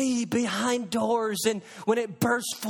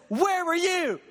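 booming shout, higher; God thundering; 7 semitones higher than usual. Acted. God demanding an answer from man, shouted. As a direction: roar the question at the listener. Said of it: "And God says".

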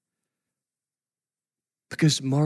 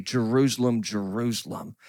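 mild weeping; 4 semitones lower, 6 dB quieter than usual. Retold or imitated. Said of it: "And he's weeping as he says that".

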